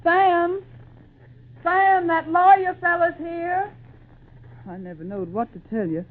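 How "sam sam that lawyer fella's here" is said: high, loud call toward the barn. Acted. Wife hollering for her husband out in the barn. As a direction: holler out across the yard to him.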